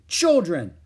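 loud sharp call to order; scolding call. A narrator's reading. Mother on the phone scolding her quarrelling daughters, loud. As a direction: stop them mid-fight.

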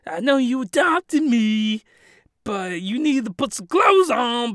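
high, put-on nervous voice; mock awkward protag. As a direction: play the flustered anime hero. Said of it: "he's like".

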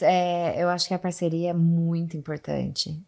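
normal talking voice

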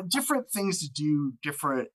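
usual speaking voice

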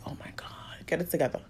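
a hushed, breathy plea to herself to hold it together; whispered self-scolding. Retold or imitated. Crying in the supermarket, scolding herself in a whisper. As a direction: whisper it under your breath like you're fighting tears.

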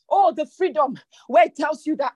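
ordinary talk

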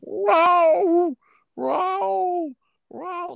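very high, drawn-out cat cries; cat meowing. Retold or imitated. Telling how the freezing stray cat cried, high meows. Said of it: "all I hear is just fucking meowing".

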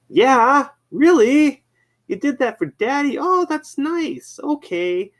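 very high-pitched daddy talk; doting dad imitation. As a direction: imitate a dad gushing to his little kid on the phone. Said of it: "he was talking to his kid".

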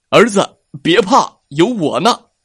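bold reassurance. Acted. Father bear comforts scared cub.

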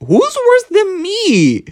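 mock outrage. Mock offence at Mart.

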